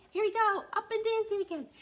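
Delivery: high, loud and excited. A narrator's reading. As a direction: pump up the kids. Excited read-aloud.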